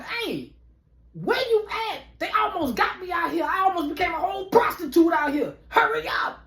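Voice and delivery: high, whiny and near tears; panicked high whine. Retold or imitated. Calling his friend to come get him, high and panicked. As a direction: beg her to hurry. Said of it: "I call this motherfucker".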